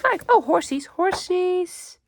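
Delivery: excited. Real, not acted. Delighted at horse pictures.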